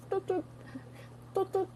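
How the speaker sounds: high, soft horn toots; two high little toots, repeated; toot imitation. Shown as a demonstration. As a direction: mimic a tiny horn, lightly. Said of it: "but toot is like".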